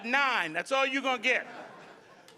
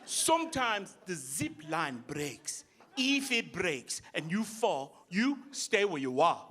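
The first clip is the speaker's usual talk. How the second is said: low, slow, deep-voiced African guide; slow low African accent, unbothered; African guide impression; 6 semitones lower, 5 dB quieter, slower than usual. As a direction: drop the voice low and drag it out like the calm guide. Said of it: "I'm like / guide repeat".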